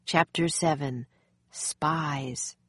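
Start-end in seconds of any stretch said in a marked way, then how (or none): none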